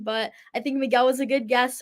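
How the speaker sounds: ordinary delivery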